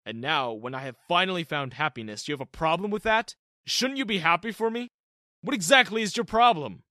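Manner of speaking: a father defending his happiness; frowning dad. Acted. Dad scolding his son for reacting badly, angry. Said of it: "Dad gave me a frown".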